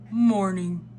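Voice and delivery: storybook Santa voice; Santa Claus. A narrator's reading. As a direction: put on a Father Christmas voice.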